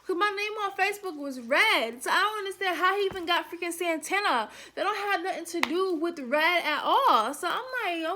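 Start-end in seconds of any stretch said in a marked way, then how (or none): none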